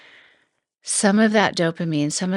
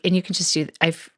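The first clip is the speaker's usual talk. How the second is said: ordinary delivery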